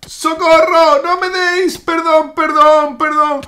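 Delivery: high cries for mercy; mock panicked pleading. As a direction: beg for help under attack, in play. Begs as friends attack. (súplica aguda de socorro, fingiendo pánico)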